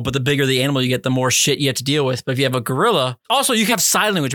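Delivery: usual speaking voice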